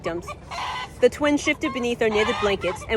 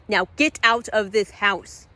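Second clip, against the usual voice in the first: slow command; 4 dB louder, slower than usual. Acted. Elise orders him out.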